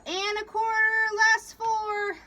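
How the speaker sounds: loud, drawn-out high call; yell. A narrator's reading. Leadsman reporting from starboard chains, yelling. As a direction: yell the depth, stretching it out. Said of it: "Kedzie yelled".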